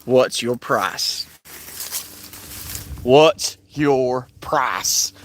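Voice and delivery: slow, low, menacing question; slow low challenge. Acted. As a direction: say it slowly, low and heavy.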